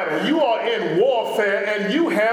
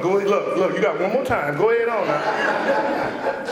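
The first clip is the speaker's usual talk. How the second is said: last-chance warning, a touch lower; daring them to carry on; warning an enemy; 5 semitones lower than usual. Retold or imitated. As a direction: warn them off. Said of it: "Go ahead to the enemy".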